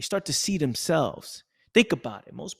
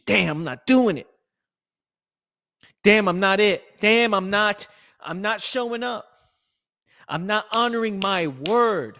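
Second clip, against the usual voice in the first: loud, higher, frustrated inner voice; frustrated self-talk; 5 semitones higher, 6 dB louder than usual. Retold or imitated. Speaker voicing his own guilty inner thoughts, louder and higher. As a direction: beat yourself up out loud.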